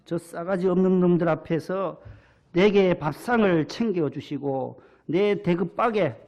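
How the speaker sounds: put-on earthy Jeolla-accented scripture reading; imitated Jeolla dialect. Pastor resumes the Psalm after an aside, in Jeolla dialect. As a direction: read it as a Jeolla villager would. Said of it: "전라도에서는".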